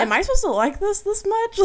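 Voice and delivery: high, startled disbelief; shocked. Retold or imitated. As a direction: ask it in stunned amazement. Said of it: "I was like".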